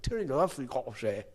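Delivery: high, garbled Scottish directions; unintelligible Scottish accent. Retold or imitated. Scottish cop giving hotel directions, garbled mock Scottish accent. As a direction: rattle off incomprehensible Scottish directions. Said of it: "the Scottish guy gives me directions".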